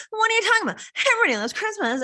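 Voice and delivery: high, incredulous mock voice of a clueless boyfriend; mocking Billy. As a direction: ham up Billy's baffled disbelief.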